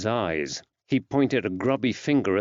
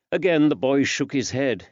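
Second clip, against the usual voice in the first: usual speaking voice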